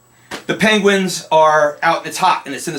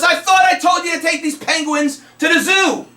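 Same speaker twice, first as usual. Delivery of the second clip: higher shouted cop scolding the driver; angry cop; 11 semitones higher than usual. Acted. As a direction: yell it like a fed-up cop. Cop scolding in told joke.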